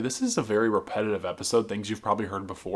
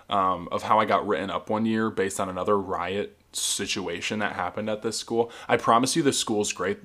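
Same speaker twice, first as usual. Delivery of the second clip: ordinary talk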